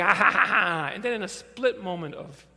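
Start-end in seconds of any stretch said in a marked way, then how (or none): 0.0-0.9 put-on loud, excited child's cackle; loud giddy laugh. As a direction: burst out laughing like a thrilled kid. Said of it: "And he's like".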